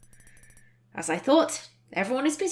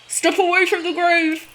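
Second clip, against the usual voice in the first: higher, louder muffled warning; 7 semitones higher, 5 dB louder than usual. A narrator's reading. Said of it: "the muffled voice makes a reappearance".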